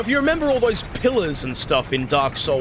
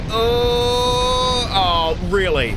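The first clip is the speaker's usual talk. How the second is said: alarmed oh; 6 semitones higher, 3 dB louder, much slower than usual. Real, not acted. Dragon about to spit fire.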